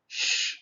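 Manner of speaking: SH as a long voiceless hush; SH sound. Shown as a demonstration. Teacher demonstrates the sound alone, SH as a long hush. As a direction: hold SH with no vowel. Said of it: "to create the sound".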